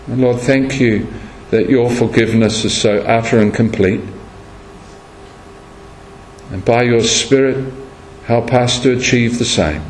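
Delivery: low, hushed prayer voice; a pastor's soft closing prayer. Acted. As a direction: pray it gently and reverently. Closing prayer after sermon.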